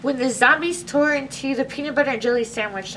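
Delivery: plain reading voice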